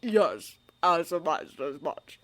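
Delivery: put-on slow, amused old wizard; a hint of twinkling amusement. A narrator's reading. Dumbledore's voice.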